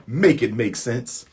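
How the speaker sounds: ordinary delivery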